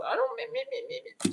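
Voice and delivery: very high whiny me me me; mock a selfish whiner's me me me. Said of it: "Shut the fuck up".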